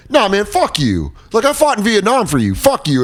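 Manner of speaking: high mock-indignant outburst; defiant vet, acted. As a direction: refuse with hammed-up outrage. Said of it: "And then he's like".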